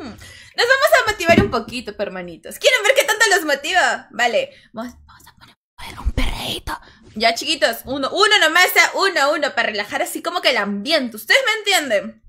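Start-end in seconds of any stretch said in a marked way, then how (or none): 4.7-6.8 hushed, voiceless aside; playful whisper. Pretend. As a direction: whisper it like a naughty secret. Confides the next music plan.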